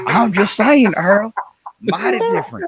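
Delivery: high laughing hillbilly voice; Southern accent through laughter. Pretend. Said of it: "the way you said it with that accent".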